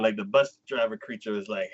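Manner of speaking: normal talking voice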